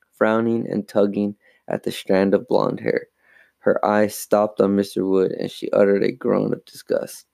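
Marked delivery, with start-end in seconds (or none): none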